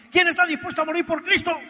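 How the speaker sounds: high, loud bellowing challenge; gunman's shout. Retold or imitated. Gunman to the churchgoers, a loud bellowing challenge. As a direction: roar it at the packed church like a gunslinger.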